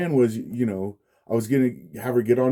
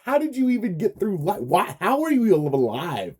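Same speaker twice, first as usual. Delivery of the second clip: incredulous, half laughing; 8 semitones higher than usual. Retold or imitated. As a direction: let the disbelief break into a laugh.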